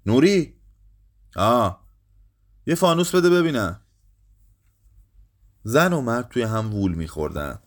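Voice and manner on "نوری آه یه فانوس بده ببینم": high, raised urgent call; shouted call. A narrator's reading. Morteza shouts to Nuri for a lantern, calling out. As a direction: call across the yard for help. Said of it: "داد کشید".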